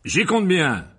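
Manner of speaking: high, forceful retort; loud voice. A narrator's reading. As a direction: bark it out as a warning. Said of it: "lança l'oncle Vernon d'une voix forte". (voix forte et autoritaire)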